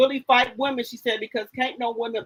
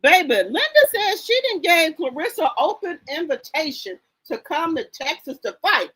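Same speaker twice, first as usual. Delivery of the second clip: ordinary talk, nothing marked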